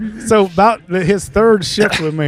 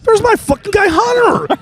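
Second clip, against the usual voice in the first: high, slow, shouted with delight; excited shout; 13 semitones higher, slower than usual. Retold or imitated. Retelling seeing Hunter in the Taylor photo, excited high shout. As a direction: yell it like you just spotted your buddy.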